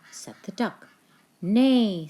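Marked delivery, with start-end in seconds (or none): 1.4-2.1 loud, high neighing horse; whinny out like a horse. A narrator's reading. Reading to a child, the horse neighs loudly. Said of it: "said the horse".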